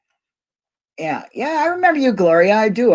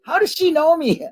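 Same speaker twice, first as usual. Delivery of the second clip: much higher, baffled self-question; puzzled wonder; 14 semitones higher than usual. Retold or imitated. Retelling her confusion at being recognized, high and baffled. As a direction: ask it to yourself in wide-eyed confusion. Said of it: "I'm like".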